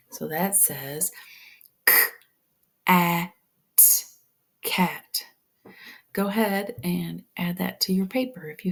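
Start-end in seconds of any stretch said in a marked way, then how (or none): 1.9-5.2 k, a, t pulled apart then blended into cat; sounding out cat. Shown as a demonstration. Teacher showing the class how to sound out cat, segmented then blended. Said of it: "make my short A".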